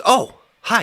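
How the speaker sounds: sudden high chirpy hello; high cutesy greeting. Retold or imitated. Plant spirit greeting him, high and chirpy.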